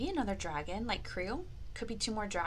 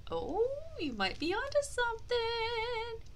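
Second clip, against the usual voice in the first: much higher, teasing tone; playful knowing; 13 semitones higher, 3 dB quieter than usual. Acted. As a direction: sing it out knowingly. Playful praise to child.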